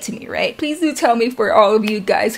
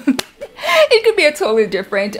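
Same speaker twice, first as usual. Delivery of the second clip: laughing through the words, higher; 6 semitones higher than usual. Real, not acted. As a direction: try to repeat it through a laugh. Breaks into laughter while repeating herself.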